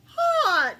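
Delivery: loud high yelp from the heat; burning shout. Real, not acted. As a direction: cry out as the spice hits. Reacting to burning hot sauce.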